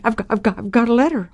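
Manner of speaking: retold excited stutter, a little high. Scared girl telling the doctor about the letter, stuttering. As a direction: stumble over the first words, restarting. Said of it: "I was stuttering".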